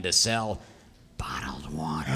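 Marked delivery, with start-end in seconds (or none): 1.2-2.1 hushed villainous whisper. Acted. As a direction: whisper it like a secret scheme. Villain reveals evil plan.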